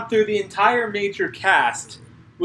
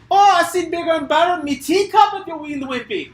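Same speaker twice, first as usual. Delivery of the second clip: put-on Scottish accent; 10 semitones higher than usual. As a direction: boast in a hammed-up Scottish voice. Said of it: "they have a Scott off".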